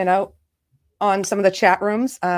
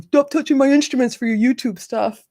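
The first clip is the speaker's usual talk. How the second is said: lightly imitated annoyed teen; a hint of a complaining teenager; mock teenage son; 3 semitones higher than usual. Mother quoting her son about borrowed instruments, lightly mocking teen voice. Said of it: "Cameron, my sixteen-year-old".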